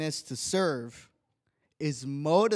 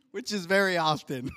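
laughing aside; 5 semitones higher than usual. Real, not acted. Joking aside to wife.

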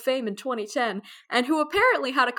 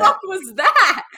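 shocked and loud, higher; 8 semitones higher, 9 dB louder, slower than usual. Real, not acted. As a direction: blurt it in loud alarm. Startled by static.

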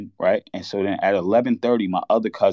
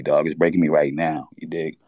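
usual speaking voice

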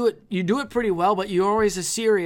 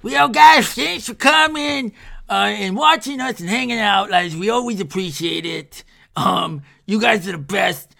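loud, upbeat outro to the viewers; outro sign-off; 5 dB louder than usual. Acted. Host signing off the video to viewers, upbeat and loud. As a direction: hype the merch and wrap the show up.

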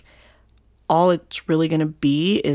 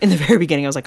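ordinary delivery

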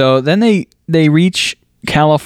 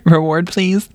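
high, hopeful mock plea; mock begging; 7 semitones higher, slower than usual. Scammer fishing for a reward, mock begging. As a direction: wheedle in a pretend little plea. Playing scammer asking for money.

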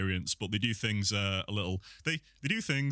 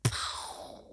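whispered pow sound effect; 3 dB quieter than usual. Pretend.

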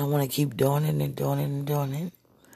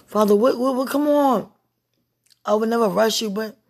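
higher, louder plea to God; impatient pleading; 6 semitones higher, 5 dB louder than usual. Real, not acted. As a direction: plead urgently, pitch pushed up.

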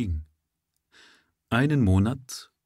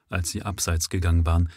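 ordinary delivery, nothing marked

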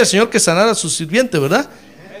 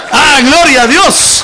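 loud, high, slow cry of glory; shouted praise; 6 semitones higher, 9 dB louder, slower than usual. Real, not acted. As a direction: cry out to God at full voice. Ecstatic praise outburst.

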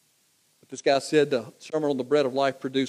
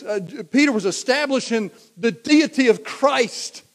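louder, driving pulpit voice; full-force preaching; 7 semitones higher, 8 dB louder than usual. Acted. Preacher rousing his congregation, preaching at full force. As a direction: preach it hard and build.

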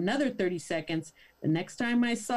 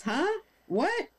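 high confused double take; mock bafflement, pitch jumping up; baffled huh what; 8 semitones higher than usual. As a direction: sound totally thrown. [name] confused by answer.

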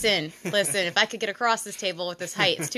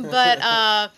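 ordinary delivery

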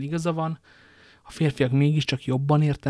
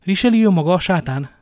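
higher, much louder outburst; furious cry; 5 semitones higher, 11 dB louder than usual. A narrator's reading. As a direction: hurl it like a curse. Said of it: "kiáltott fel a fiatalasszony". (dühös, hangos kiáltás)

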